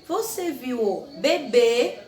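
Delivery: "Você" and "bebê" said slowly, loud, with closed E; reading the question slowly as a model; slow, careful closed E. Shown as a demonstration. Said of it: "o som fechado do E".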